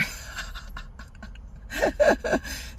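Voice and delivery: high airy burst of laughter; breathy laugh. Real, not acted. Laughing at her own vodka story. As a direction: laugh at yourself.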